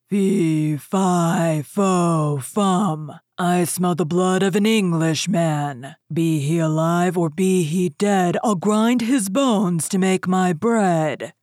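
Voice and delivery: loud, rough booming giant chant; ogre calling out. A narrator's reading. Ogre sniffs out an Englishman, booming. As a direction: boom out each beat with menace. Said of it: "began sniffing and calling out".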